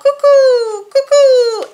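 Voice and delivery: high, loud two-note call; cuckoo call. A narrator's reading. Narrator voices the calling cuckoo, high loud birdcall. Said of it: "The cuckoo called".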